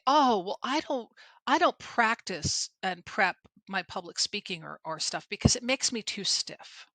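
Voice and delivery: snotty imitation of people making excuses; snotty mocking quote. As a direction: mimic them with snotty disdain. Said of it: "I'm being snotty in my tone".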